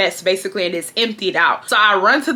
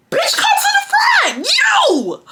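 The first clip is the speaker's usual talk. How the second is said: high screaming shout; slower than usual. Retold or imitated. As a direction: scream it at her, fed up. Yelling at manager.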